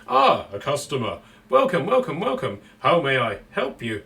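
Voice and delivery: Kenku shopkeeper, voice jumping around; several voices in one sentence. Acted. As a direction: switch voices mid-sentence like a crow. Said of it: "he talks in, several different voices in one sentence".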